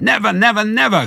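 high, loud insistent cry. A narrator's reading. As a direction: cry it out as a fierce refusal. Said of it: "cried Boggis".

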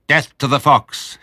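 loud, high, slow battle cry; savage shout. A narrator's reading. Bunce shouting from his tractor at the foxhole. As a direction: bellow it like a war cry. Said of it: "shouted Bunce".

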